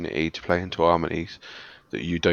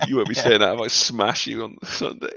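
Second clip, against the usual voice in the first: teasing threat through laughter; playful laughing challenge; laughing taunt; 3 semitones higher, 8 dB louder than usual. Real, not acted. Playful trash talk after laughs.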